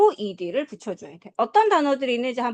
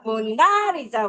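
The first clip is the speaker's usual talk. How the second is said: "모나리자"의 "나"를 길고 세게; 느리게, "모나리자"의 "나"에 강세; slower than usual. Shown as a demonstration. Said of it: "이게 강세잖아". ("모나리자"의 "나"를 세게 끌어서)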